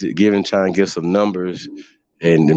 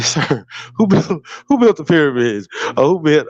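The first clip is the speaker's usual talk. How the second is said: mock moderator question; 7 semitones higher than usual. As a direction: play the stiff moderator pushing back, pitched up. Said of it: "I said".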